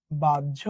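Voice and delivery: বাহ্য repeated low as "baj-jho"; correct pronunciation demo. Shown as a demonstration. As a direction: sound out বাহ্য with jjh for the class. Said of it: "এটার উচ্চারণ কি বলতো?". (শুদ্ধ উচ্চারণে নিচু স্বরে বাহ্য, বাজ্ঝো)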